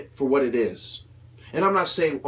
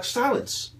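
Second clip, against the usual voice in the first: a hammed-up stern order, pitched higher; mock stern command; 9 semitones higher than usual. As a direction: bark one word like a secret society shutting you up. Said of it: "The Masons will be like".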